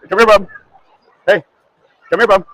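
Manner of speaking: calling out. Real, not acted. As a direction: call him over across the fair. Calling his son over outdoors.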